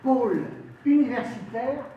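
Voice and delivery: high, loud oratory in separated phrases; rousing political speech. Real, not acted. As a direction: proclaim it to the hall, pausing between phrases. Councillor delivers a formal speech.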